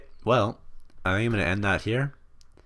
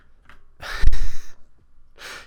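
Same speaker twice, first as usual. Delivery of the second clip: big unvoiced laugh, much louder; loud burst of laughter; 17 dB louder than usual. Real, not acted. Laughs at the Hammy drama.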